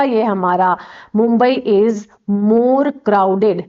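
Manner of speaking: slow, word by word; slow dictation. Shown as a demonstration. Teacher dictating example sentence to class, slow and deliberate. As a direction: spell out the example sentence slowly for students. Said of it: "ये हमारा object हो गया positive का".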